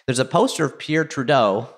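usual speaking voice

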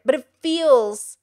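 ordinary delivery

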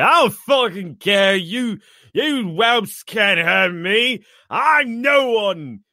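the shot warrior snarls at his attackers, slow and defiant; defiant battle roar. Acted. Shouted to the whelps who shot him, slow defiant roar.

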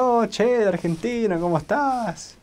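high, drawn-out mock Argentine greeting; imitación del acento argentino. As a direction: playfully exaggerate a sing-song Argentine accent. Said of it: "toda la gente decía". (acento argentino imitado, agudo y alargado)

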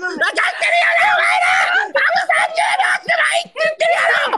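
high, frantic shouting with laughter breaking through; panicked yelling. Real, not acted. Streamer yelling at his viewers as the battle ends, frantic high shout. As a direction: scream at the viewers as the last seconds run out.

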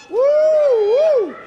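high, loud excited woo; excited high hoot. Real, not acted. Owner hooting with delight over the dog's treat, high and loud.

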